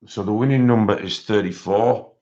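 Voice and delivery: ordinary talk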